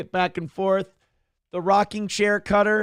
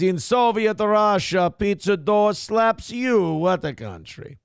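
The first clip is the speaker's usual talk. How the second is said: mock Russian accent; 3 semitones higher, slower than usual. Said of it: "parody account says".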